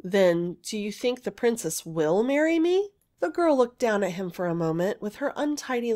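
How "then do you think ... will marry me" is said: ordinary delivery, nothing marked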